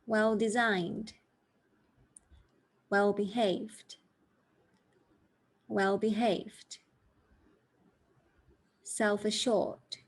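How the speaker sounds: slow, clear three-syllable words; slow stress model. Shown as a demonstration. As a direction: read slowly for learners to repeat. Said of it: "just three syllables for each word".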